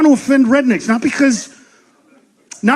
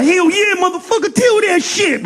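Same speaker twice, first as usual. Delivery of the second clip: higher, hyped-up hollering approval; 7 semitones higher than usual. Retold or imitated. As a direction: cheer him on like a fired-up crowd.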